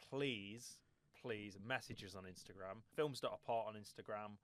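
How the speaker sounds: ordinary talk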